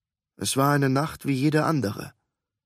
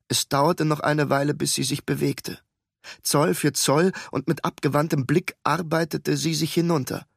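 plain reading voice

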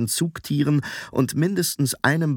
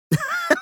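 put-on very high boyish giggle; short, high titter; teasing laugh. A narrator's reading. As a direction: giggle at your own joke. Boy laughs at his own joke.

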